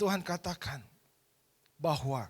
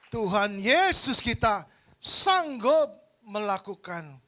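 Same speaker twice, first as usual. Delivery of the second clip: preaching; 4 semitones higher, 11 dB louder, slower than usual. Acted. Preacher proclaims loudly.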